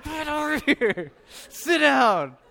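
high, loud, strained parent command; stressed scolding. Retold or imitated. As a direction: act out a dad losing his cool. Acts out scolding his toddler.

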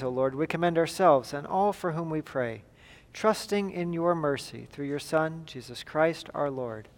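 ordinary talk, nothing marked

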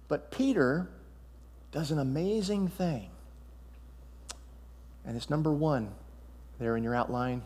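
quiet emphasis on calling out to Jesus; hushed sermon point. Real, not acted. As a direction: bring the room in close, quietly. Pastor drops to intimate point.

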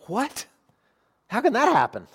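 high-pitched incredulous question; mock disbelief. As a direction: play astonished, pitch leaping up. Voices disbelief at no guilt.

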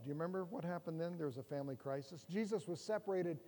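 ordinary talk, nothing marked

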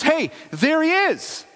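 high, slow, thrilled cry of recognition; excited call. Acted. Beggar sees Jesus coming, high excited call. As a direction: sing out the discovery.

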